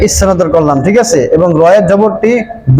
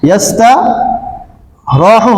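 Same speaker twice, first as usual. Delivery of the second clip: ইয়াস্তারাহু drawn out very slowly; slow demo; 2 semitones higher, much slower than usual. Shown as a demonstration. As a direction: stretch ইয়াস্তারাহু out for the class. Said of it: "কিভাবে সহজ করব?".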